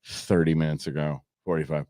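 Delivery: low, muttered grumble; bored banquet guest. Retold or imitated. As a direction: grumble under your breath at the overrun. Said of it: "looking at the program going".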